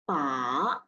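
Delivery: "pa" dipping low then rising; third tone. Shown as a demonstration. Teacher drilling the third tone of "pa" for students. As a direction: drop "pa" down deep, then lift it. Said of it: "Third tone".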